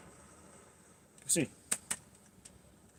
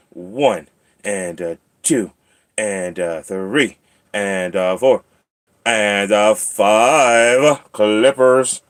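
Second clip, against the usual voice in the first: building singsong count; 2 semitones lower, much slower than usual. Pretend. Counting nail clips for the cat, building singsong. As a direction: stretch each number, then land big on the last.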